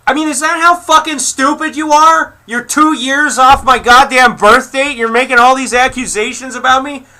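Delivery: real furious outburst, pitch way up; furious shouting. Real, not acted. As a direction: blow up over the wrong age, near screaming. Furious reply to age insult.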